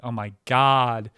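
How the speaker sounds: slow, drawn-out put-on woman's groan; mock exasperated woman. Comic imitating a woman on the phone, exasperated. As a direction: drag it out like a fed-up lady.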